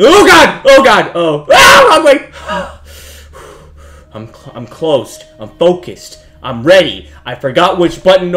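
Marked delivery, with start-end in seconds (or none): 0.0-2.3 loud cries shooting up high; startled panic. Real, not acted. Player startled in the dark, panicked yelling. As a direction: yelp in sudden alarm.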